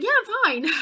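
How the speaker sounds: high, loud, over-bright insistence; fake fine. Pretending to be okay afterwards.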